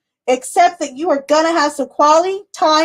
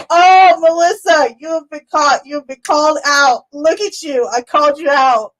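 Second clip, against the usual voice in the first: laughing tease; 3 semitones higher, 4 dB louder than usual. Real, not acted. Catching Melissa in chat, laughing tease. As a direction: tease her through laughter.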